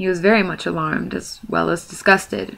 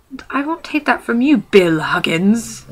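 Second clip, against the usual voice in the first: high troll voice slowing on the name; angry troll retort; 4 semitones higher, 3 dB louder than usual. Acted. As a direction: threaten before throwing a punch. William retorts before punching.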